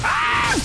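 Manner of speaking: very high, sudden scream; startled scream. Real, not acted. Gamer hit by a surprise jump attack, startled scream. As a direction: cry out in high-pitched shock.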